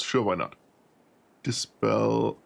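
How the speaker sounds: usual speaking voice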